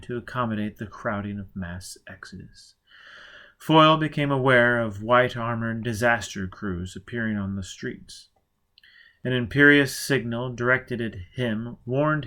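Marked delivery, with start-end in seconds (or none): none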